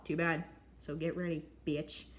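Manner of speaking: normal talking voice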